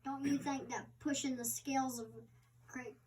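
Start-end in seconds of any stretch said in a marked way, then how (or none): none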